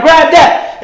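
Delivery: ordinary talk, nothing marked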